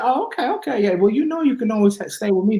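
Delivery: a hint of mom reassuring her son; mom's voice. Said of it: "She said".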